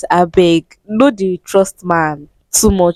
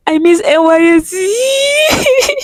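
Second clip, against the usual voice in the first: slow, much higher, half-crying wail; mock wail; 11 semitones higher, much slower than usual. Missing youth service, a mock crying wail. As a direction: wail it out, hamming up the misery. Mock wailing over lost allowance.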